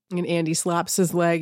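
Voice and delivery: ordinary delivery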